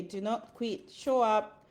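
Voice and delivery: normal talking voice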